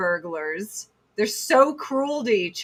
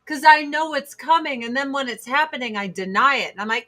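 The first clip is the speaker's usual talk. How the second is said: normal talking voice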